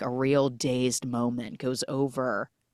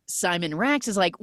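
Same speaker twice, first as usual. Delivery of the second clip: normal talking voice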